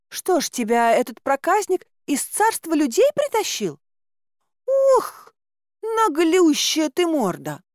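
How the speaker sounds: high, exclaiming folksy voice; scolding caretaker woman. A narrator's reading. Caretaker sizing up stranger, then scolding chick, high voice.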